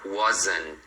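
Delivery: wasn't as a low "wozn", no T; tongue to the palate to end wasn't; tongue-tip N. Shown as a demonstration. Teacher showing tongue position, wasn't ending on N. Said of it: "coloca a ponta da língua".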